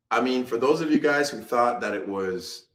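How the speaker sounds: usual speaking voice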